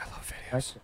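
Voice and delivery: breathy excited whisper. Acted. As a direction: whisper it like a secret thrill. Excited whisper about videos.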